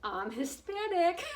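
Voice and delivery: high, slow, drawn-out mock boast; mock entitled announcement. To people waiting at Publix, mock entitled and slow. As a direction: announce it proudly, hammed up. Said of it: "I could see myself".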